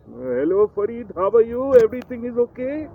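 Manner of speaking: put-on prank caller in a high, loud Indian accent. Prank call to his friend on air in a loud, high Indian accent. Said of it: "I did this Indian accent".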